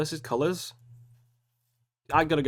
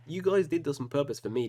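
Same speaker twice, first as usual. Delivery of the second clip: ordinary talk, nothing marked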